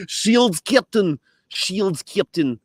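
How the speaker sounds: very high mock Russian accent; hammed-up, alarmed Russian accent; Chekhov. Crewman warning the captain, alarmed in a Russian accent. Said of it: "the accent that, that Chekhov had in Star Trek".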